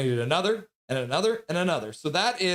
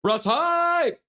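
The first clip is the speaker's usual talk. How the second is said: hype shout; 6 dB louder than usual. Acted. Reads hype chat message out.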